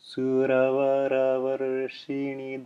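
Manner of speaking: slow chant-like recitation with tapped r sounds; Sanskrit recitation. Shown as a demonstration. Teacher reciting a Sanskrit example line to the class. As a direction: recite slowly with the tongue lightly tapping each r. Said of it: "lightly throwing the tongue against the roof".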